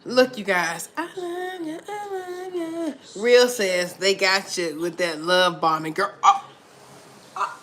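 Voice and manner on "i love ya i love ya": mock sweet. Crooning love to the viewers.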